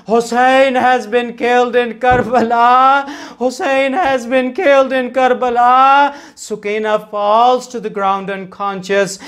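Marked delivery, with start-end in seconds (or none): 0.1-6.1 crying out. Acted. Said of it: "Jibreel cries out".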